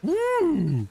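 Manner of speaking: high cheer imitating Shane; mimicking Shane's high, happy "Woo!"; imitating excited woo. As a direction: yelp it like an excited mate spotting an Aussie. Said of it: "Shane's like".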